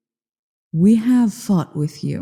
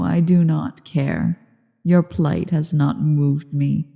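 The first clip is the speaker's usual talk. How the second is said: normal talking voice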